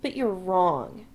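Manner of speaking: put-on slow, solemn drunk verdict. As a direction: pronounce it gravely. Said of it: "Chris informs him solemnly".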